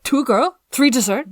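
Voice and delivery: loud, broken-English server quote; mimicking the server.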